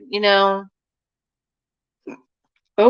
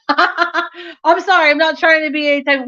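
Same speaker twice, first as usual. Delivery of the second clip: giggly sorry; 8 semitones higher than usual. Real, not acted. Laughing apology to a viewer with an accent. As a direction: laugh it off sheepishly.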